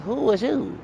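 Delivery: high-pitched incredulous question; taken aback. Retold or imitated. Reacting to a friend's suddenly changed accent, taken aback. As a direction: ask it high, in mock disbelief.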